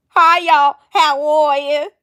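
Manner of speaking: hammed-up Alabama farm girl, drawn out; terrible farm-girl accent. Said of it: "My terrible farm girl accent".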